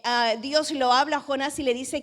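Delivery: ordinary talk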